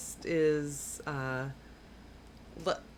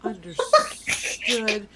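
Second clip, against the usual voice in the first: slow, higher, louder "understood" through laughter; laughing acknowledgement; 4 semitones higher, 9 dB louder, much slower than usual. Acted. Teammate answering a warning about stray arrows, laughing. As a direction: drag it out while laughing. Player reacts to veiled threat, laughing.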